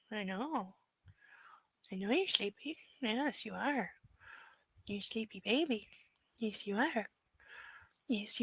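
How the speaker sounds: hushed, tender murmurs to a sleepy puppy; soft pet cooing. Real, not acted. Owner softly soothing her sleepy puppy, hushed. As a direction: lull the dog gently, barely above a murmur.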